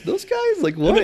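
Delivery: very high-pitched retold astonishment; comic imitation of startled recognition; mock disbelief. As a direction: squeak it out as the stunned prisoner spotting them. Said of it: "he kinda recognized us. He's like".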